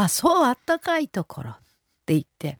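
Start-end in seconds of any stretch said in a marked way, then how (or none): 0.0-1.5 higher, lightly voiced old mother repeating it; a hint of an elderly mother echoing back; mother's echo. Mother echoes the husband's answer in a higher voice. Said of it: "そしたら、".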